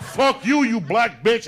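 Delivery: loud, high comeback in a mock quarrel; acted angry shout. Friend cursing back at the woman, acted angry shout. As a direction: fire the insult back at full volume.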